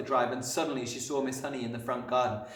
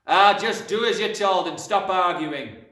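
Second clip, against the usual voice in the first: slow, loud, pitched-up scolding; exasperated dad at full volume; angry shout; 8 semitones higher, 12 dB louder than usual. A narrator's reading. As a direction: bellow like a fed-up father. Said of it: "the father shouted".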